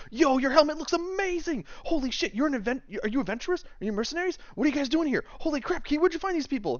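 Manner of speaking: very high, gushing fanboy voice; wide-eyed excitement. Acted. Runs over to the adventurers, wildly excited. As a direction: fire off questions in starstruck amazement. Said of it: "like, goes completely wide-eyed".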